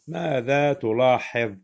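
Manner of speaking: usual speaking voice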